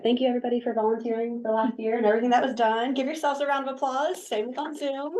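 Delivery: bright, high, cheerful thank-you; rally the room with high praise; excited thanks. Real, not acted. Thanking volunteers, leading applause.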